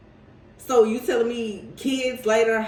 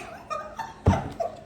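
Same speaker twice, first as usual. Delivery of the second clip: high squealing laugh; 4 dB quieter than usual. Real, not acted. Laughing at the quote.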